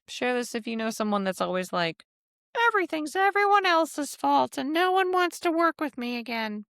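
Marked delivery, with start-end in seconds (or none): 2.7-6.6 mock a blamer feeling sorry for themself; imitating a whiny complainer. Mocking a self-pitying person.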